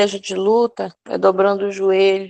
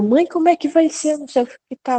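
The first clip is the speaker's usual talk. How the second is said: imitating a panicked son, higher pitch; frantic kid asking mum for answers, pitched up; desperate son; 8 semitones higher, faster than usual. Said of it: "e ele desesperado". (filho desesperado imitado, voz mais aguda)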